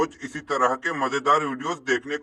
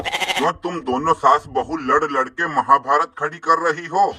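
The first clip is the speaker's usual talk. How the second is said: ordinary delivery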